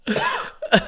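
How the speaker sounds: violent choking cough; real coughing fit, coffee down the wrong pipe; coughing up water. Real, not acted. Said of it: "that went down the wrong pipe".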